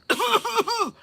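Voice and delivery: high, forced fake cough; warning cough. Worker alerts coworker the boss arrived, pointed cough. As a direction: cough pointedly as a secret signal. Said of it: "I didn't cough loud enough".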